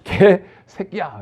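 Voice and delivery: "개 새끼야" pulled apart slowly, 개 then a pause then 새끼야; separated words. Shown as a demonstration. Preacher showing the congregation to say "개 새끼야" as two words, slow. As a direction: say "개 새끼야" slowly with a clear gap between 개 and 새끼. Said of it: "떨어뜨려야 돼요".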